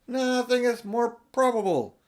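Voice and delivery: put-on slow, lifted old professor; lightly voiced learned old man; a hint of a dry old scholar; professor's voice.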